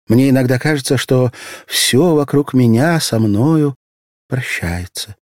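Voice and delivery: a lightly voiced sad young girl; young girl's voice. A narrator's reading. Asya's voice in narration.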